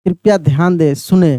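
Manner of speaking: ordinary talk, nothing marked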